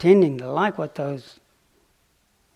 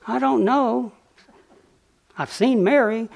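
mock heckling; 7 semitones higher than usual. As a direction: taunt like a guard heckling the monk. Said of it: "started heckling".

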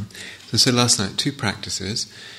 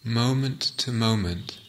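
guided meditation. Acted. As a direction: ease them into the sitting, unhurried.

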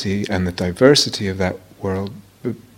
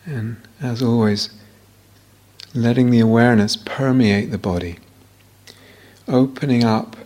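guided meditation. Real, not acted. Teacher leading students into body awareness, guided meditation voice. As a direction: guide them unhurriedly, leaving silence between phrases.

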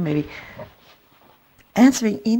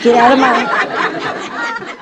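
higher, louder, slow shout through laughter; retold shout; 8 semitones higher, 8 dB louder, much slower than usual. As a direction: shout at the squirrel, half laughing. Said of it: "and I shouted at it".

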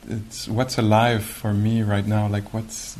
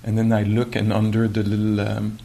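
normal talking voice

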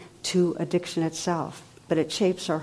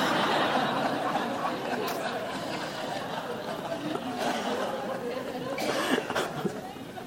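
breathy laugh; 6 semitones higher, 8 dB quieter than usual. Real, not acted. Laughing at own joke.

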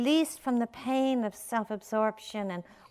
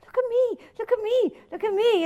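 high-pitched imitation of an insistent child; small child; 13 semitones higher, 5 dB louder than usual. Small children calling to their parent, imitated insistent whine. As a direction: chant it like a kid wanting attention. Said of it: "that was one of their mantras".

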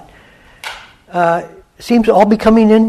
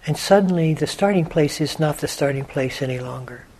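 softer meditation voice with long pauses; guided meditation; 5 dB quieter than usual. Acted. Teacher guiding listeners to let the quiet in, unhurried meditation voice. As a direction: guide listeners gently inward, leaving long silences.

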